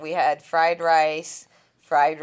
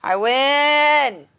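triumphant; 9 semitones higher, 8 dB louder than usual. Real, not acted. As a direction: shout out a victory, high and loud. Winning the hangover count contest.

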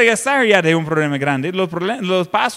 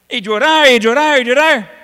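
louder with each repeated llorar; building emphasis; 6 semitones higher, 7 dB louder than usual. Real, not acted. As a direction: drive the repetition up, insistent. Describes people crying, repeated. (repetición enfática y creciente)

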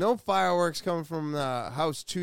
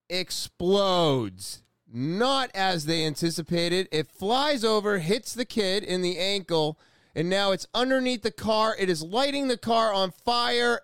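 higher, eager commentary; excited play-by-play; 4 semitones higher than usual. Real, not acted. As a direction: narrate the action as it happens. Live play-by-play of video.

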